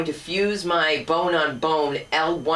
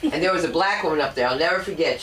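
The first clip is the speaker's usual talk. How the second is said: ordinary talk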